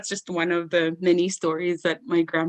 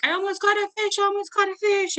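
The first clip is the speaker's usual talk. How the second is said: put-on much higher, bubbling excited boy voice; excited cousin; 13 semitones higher, faster than usual. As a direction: brag like a thrilled kid with a bleeding hand. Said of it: "like, just excited".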